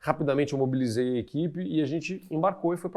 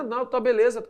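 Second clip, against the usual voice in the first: breezy; 10 semitones higher, 6 dB louder than usual. Retold or imitated. Producer's carefree reply.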